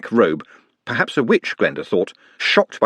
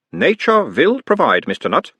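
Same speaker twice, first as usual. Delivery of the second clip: put-on mild Uberwald accent; 7 semitones higher than usual. A narrator's reading. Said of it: "mild Uberwaldian accent".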